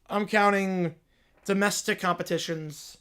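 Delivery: usual speaking voice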